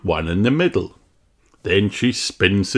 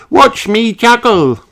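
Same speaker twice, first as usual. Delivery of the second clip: put-on much higher, louder showing-off child; Susie Sheep voice; 14 semitones higher, 8 dB louder than usual. Susie shows off her juggling, high child voice. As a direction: show off to your friends.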